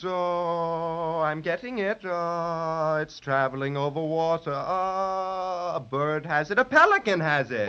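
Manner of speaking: hushed mystic trance voice. Acted. Magician in a trance seeking the star, hushed and mystic. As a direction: murmur as if seeing visions. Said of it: "go into a quick trance".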